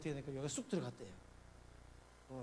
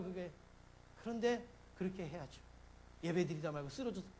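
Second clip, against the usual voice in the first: ordinary talk, nothing marked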